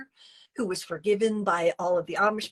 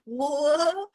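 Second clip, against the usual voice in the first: high, drawn-out shocked whoa; stunned whoa; 8 semitones higher, 4 dB louder than usual. Retold or imitated. Woman shocked by ex's attack, stunned. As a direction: recoil in disbelief.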